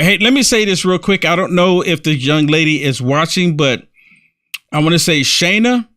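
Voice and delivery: normal talking voice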